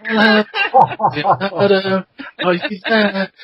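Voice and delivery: high, drawn-out call into a hole; mock hollering, pitched high; retold yelling out. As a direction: imitate a kid calling for lost heroes. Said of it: "I just started yelling out".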